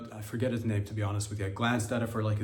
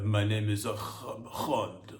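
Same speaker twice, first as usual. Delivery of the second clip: mock Middle Eastern accent; 2 semitones lower, much slower than usual. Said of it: "I don't want a Middle Eastern accent".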